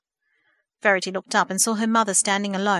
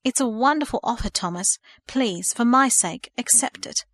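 tearful plea; 4 semitones higher than usual. Acted. Mother begs her son to accept, pleading. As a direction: beg him gently through tears.

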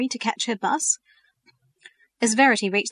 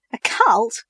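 a high, louder astonished cry; 6 semitones higher, 6 dB louder than usual. A narrator's reading. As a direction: toss the word back in disbelief. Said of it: "Verity laughed in astonishment".

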